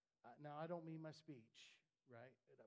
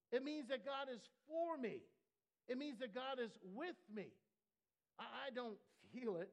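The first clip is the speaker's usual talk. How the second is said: loud, climbing sermon peak, much higher on good; preaching at full force; 6 semitones higher, 7 dB louder than usual. Acted. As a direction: shout it out to the church.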